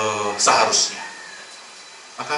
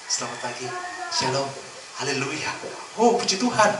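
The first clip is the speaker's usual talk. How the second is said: sugary churchy greeting, rising at the end; mock pious greeting; 4 dB quieter than usual. Preacher mocking a two-faced churchgoer's sweet greeting. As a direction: put on a sweet holy front. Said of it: "Jangan kalau di depan".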